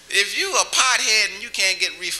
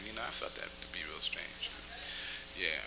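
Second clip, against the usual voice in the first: whispered aside; 13 semitones lower, 19 dB quieter than usual. Real, not acted. Aside to audience about cop.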